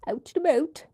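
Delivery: mock Canadian accent on "about", giggling. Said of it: "Was that a Canadian accent?".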